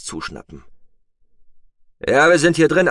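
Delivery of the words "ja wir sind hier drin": loud, raised answering shout; calling back. A narrator's reading. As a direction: shout back through the door. Answering the call through door. (laut zurückgerufen)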